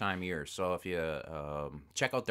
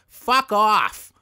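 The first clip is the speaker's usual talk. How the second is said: higher, much louder shout; furious shout; 14 semitones higher, 16 dB louder than usual. Retold or imitated. Husband grabbing the phone from his wife, loud furious shout. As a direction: blast it down the phone. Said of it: "I'll grab the phone from her and go".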